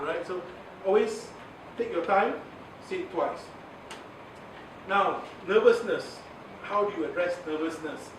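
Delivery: ordinary talk, nothing marked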